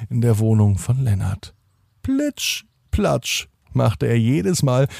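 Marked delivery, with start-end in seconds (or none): 2.0-2.6 splashy sound word. A narrator's reading.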